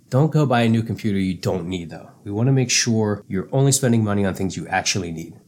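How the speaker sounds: usual speaking voice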